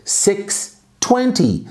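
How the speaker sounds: careful teacher demo; slow model. Shown as a demonstration. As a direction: stretch it for the class. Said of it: "basta falar seis vinte".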